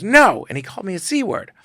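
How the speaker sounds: high, loud tearful no; upset model. Retold or imitated. Model answers that she was not paid, high and loud. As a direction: wail a short high no. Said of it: "She goes".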